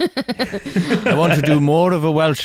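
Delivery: Anthony Hopkins, mock Welsh; hammed-up Welsh voice. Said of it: "He's like".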